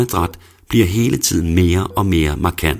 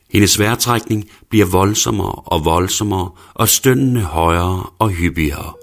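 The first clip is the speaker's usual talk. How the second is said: ordinary delivery, nothing marked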